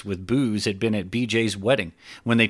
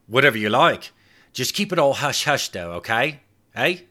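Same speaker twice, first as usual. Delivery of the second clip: higher, bribing voice; coaxing Clive; 4 semitones higher than usual. Acted. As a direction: Clive offering treats for secrecy. Clive bargaining.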